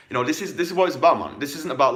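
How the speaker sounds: fired up. Real, not acted. Fired-up coach hammering his point.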